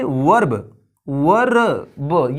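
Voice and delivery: वर्ब with a clear R, then drawn out slowly as "war-ra-ba"; wrong, R sounded. Shown as a demonstration. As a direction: stretch out every sound of वर्ब, R included. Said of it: "ये वर्ब नहीं है". (वर्ब में आर खींचकर गलत उच्चारण)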